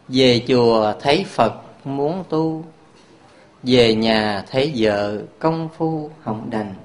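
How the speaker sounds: slow recited couplet; poem recitation. Shown as a demonstration. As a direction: recite the verse slowly and quietly. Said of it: "đọc câu thơ".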